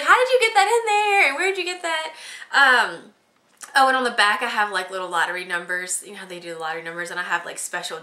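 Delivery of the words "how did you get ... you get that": very high, loud, delighted disbelief; a husband stunned by the surprise; amazed questions. Retold or imitated. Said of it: "He was like".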